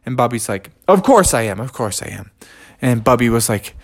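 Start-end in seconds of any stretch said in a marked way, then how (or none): 0.9-1.6 a touch higher, firm insisting; hurt bear insisting to his friends he's fine; brave insisting. Acted. 1.6-2.3 much lower and softer, trailing off; hurt bear quietly repeating he's fine; soft fading repeat. Acted. Bubby insists he is fine.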